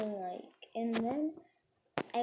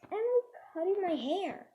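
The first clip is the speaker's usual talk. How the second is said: acted accusing cry; 8 semitones higher, 4 dB louder than usual. Storyteller tattles to teacher in story.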